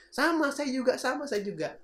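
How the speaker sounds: high-pitched mock agreement; menirukan antusias. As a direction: chime in high and eager. Imitasi orang merasa banyak samanya. (menirukan setuju dengan antusias, nada tinggi)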